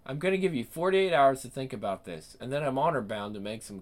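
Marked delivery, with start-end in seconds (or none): none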